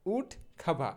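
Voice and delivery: উড খাভা with aspirated kh and no r; corrected pronunciation. Shown as a demonstration. Teacher correcting woodcarver, উড খাভা ending in open "va". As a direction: say উড খাভা as "wood KHA-va". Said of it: "সঠিক উচ্চারণটা হবে".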